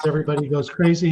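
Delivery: usual speaking voice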